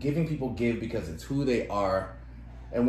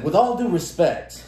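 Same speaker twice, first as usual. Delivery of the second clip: slow, louder, put-on "With all due respect."; mock condescending; 6 semitones higher, 7 dB louder than usual. Mocking a coworker's polite put-down, slow and snooty. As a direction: drawl it like a smug coworker. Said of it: "I can hear a million conversations".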